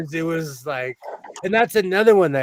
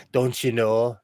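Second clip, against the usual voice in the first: put-on lower, joking Minnesota accent; 5 semitones lower than usual. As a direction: toss in a Minnesota tag line. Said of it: "I'll go full Minnesota".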